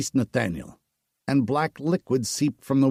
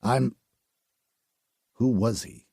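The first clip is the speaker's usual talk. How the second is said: dazed and quiet; 6 semitones lower, 5 dB quieter than usual. Acted. Dazed survivor answers.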